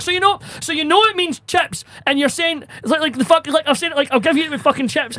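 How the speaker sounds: high, strained retelling of losing patience; barely contained exasperation. Retold or imitated. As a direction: act out a server trying not to explode. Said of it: "trying not to go fucking mental".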